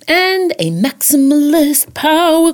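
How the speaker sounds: slow, high, grand diva proclamation; campy drag queen. Pretend. Power queen catchphrase, dramatic.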